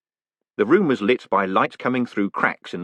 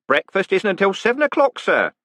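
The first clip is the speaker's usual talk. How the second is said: reproachful; 11 semitones higher, 3 dB louder than usual. Acted. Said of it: "said the warder reproachfully".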